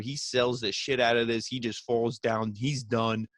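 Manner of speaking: usual speaking voice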